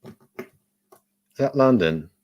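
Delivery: put-on slow, drawn-out London accent. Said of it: "Can you please do your London accent for me".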